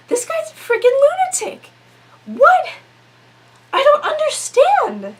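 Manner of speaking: baffled, almost squeaking at the absurdity; exasperated disbelief. Real, not acted. Recounting the teacher's accusation, high exasperated disbelief.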